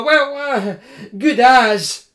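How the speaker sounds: high, stammering, fussing student voice; nervous hungry student. Acted. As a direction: fret and stammer about supper. Koma voice.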